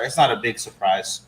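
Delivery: ordinary delivery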